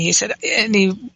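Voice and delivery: usual speaking voice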